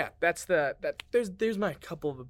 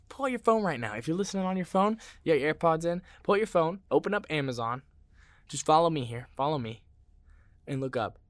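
ad read; 4 semitones higher, 4 dB louder than usual. Acted. Ad read urging listeners.

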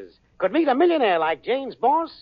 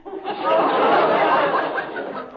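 hearty laugh; 8 semitones higher than usual. Acted. Al laughs at Irma's joke.

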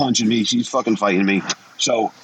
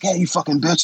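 retold yell; 7 semitones higher than usual. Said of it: "They start screaming at her".

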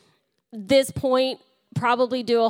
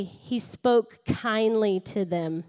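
slow repeat line; 3 semitones lower, slower than usual. Shown as a demonstration. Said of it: "Turn to your neighbor and say".

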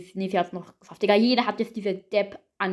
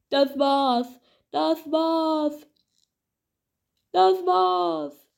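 dramatic lament; 7 semitones higher, much slower than usual. Real, not acted. Repeating game over, rising.

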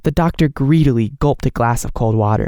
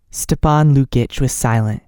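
usual speaking voice

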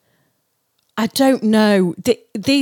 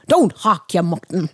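Northern Irish lilt on the saying; Irish accent; 4 semitones lower than usual. Pretend. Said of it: "Do it again".

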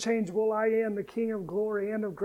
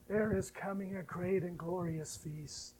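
soft, low announcement of the feast; hushed foretelling; quiet promise; 3 semitones lower, 9 dB quieter than usual. Acted. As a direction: hold it back, almost confiding. Preacher builds the feast promise.

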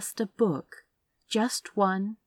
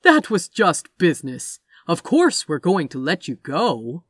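lightly voiced glib salesman, louder; salesman-like assurance; 7 dB louder than usual. A narrator's reading. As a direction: brush it off with loud, easy assurance. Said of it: "every inch a used car salesman".

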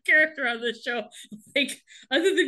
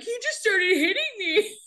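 high-pitched tattletale imitation; mock whining complaint; 11 semitones higher than usual. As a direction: whine it up high, hammed-up. Acts Wolfgang complaining to Jess.

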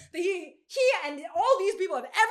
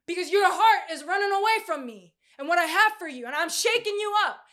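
louder, higher pleading call to a runaway; God calling out; 3 semitones higher, 6 dB louder than usual. Acted. God calls out to a heart running away, loud and pleading. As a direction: preach it as God knocking at the door.

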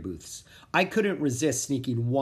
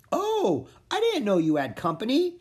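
lightly voiced little creature; 9 semitones higher than usual. A narrator's reading.

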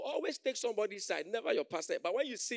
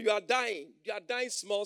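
ordinary delivery

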